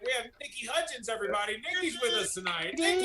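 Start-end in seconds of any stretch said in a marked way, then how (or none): 2.8-3.0 high, loud cheer of a name; calling out excitedly. Real, not acted. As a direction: hype up the guest's name. Host calling out the guest's name.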